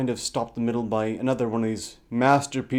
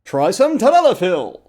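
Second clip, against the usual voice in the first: mock sales pitch; 9 semitones higher, 8 dB louder, faster than usual.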